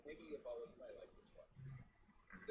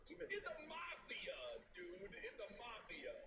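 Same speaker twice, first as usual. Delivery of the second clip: incredulous exclaim; slower than usual. Real, not acted. Exclaiming, pitch jumps up.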